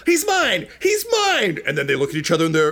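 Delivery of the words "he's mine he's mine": high-pitched cartoon yell; comic high-voiced squabble; squabbling creature. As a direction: claim it in a high, greedy squeal. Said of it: "And he's like".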